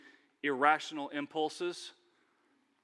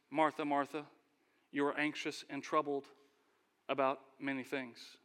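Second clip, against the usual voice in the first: gentle rebuke; 4 semitones lower, 5 dB quieter than usual. Acted. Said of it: "Jesus was very gentle".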